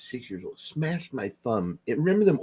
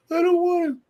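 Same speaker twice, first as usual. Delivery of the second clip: put-on much higher, louder whiny child voice; whiny kid; 4 dB louder than usual. A teased kid whining to his mom, high and louder.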